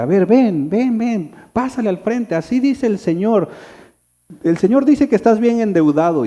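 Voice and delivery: a hint of a prophet summoning someone forward; self-styled prophet. Prophet calls a churchgoer to the front, lightly acted oracle. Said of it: "un profeta o alguien que diga".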